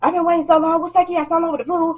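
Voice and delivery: mock tantrum of a waiting customer; whiny customer. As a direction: whine like a spoiled kid about the food. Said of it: "going on this little baby rampage".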